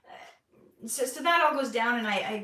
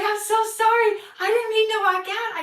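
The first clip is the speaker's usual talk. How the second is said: frantic apology; 8 semitones higher, 8 dB louder than usual. Retold or imitated.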